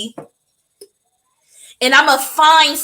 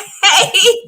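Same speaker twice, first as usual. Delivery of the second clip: laugh; 8 semitones higher, 5 dB louder than usual. Real, not acted. Laughing at her own joke.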